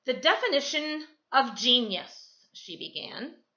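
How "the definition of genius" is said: firm, slightly louder teacher reading aloud; brisk no-nonsense reading. Acted. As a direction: read it like a strict teacher. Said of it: "in this no-nonsense voice".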